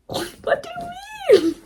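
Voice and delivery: very high, loud, slow squeal of disbelief; disbelieving shriek. Retold or imitated. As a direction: shriek it in delighted shock. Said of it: "I'm like".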